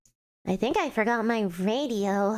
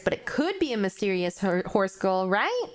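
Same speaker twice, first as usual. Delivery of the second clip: normal talking voice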